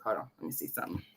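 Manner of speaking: unvoiced whisper to herself; whispered aside. Real, not acted. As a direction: whisper it while searching the phone. Checking her phone, muttering to herself.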